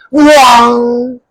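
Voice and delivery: loud delighted exclamation; excited wow. Real, not acted. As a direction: gasp of wonder at the eagle. Excited about eagle.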